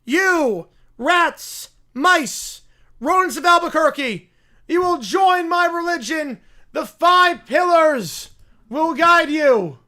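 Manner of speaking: high, loud zealot sermon to the rats; rally the rodents like a mad prophet; preaching bear. Acted.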